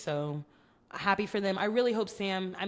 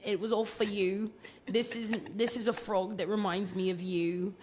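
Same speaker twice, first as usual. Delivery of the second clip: half-hearted British accent mocking a sentimental proposal; 2 semitones higher than usual. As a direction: parody a gushing suitor in a loose British accent. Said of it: "This is more of a British accent".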